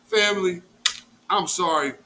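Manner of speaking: ordinary talk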